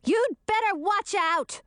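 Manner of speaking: high slow warning shout. A narrator's reading. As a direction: shout the threat after her. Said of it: "shouted Chartreuse".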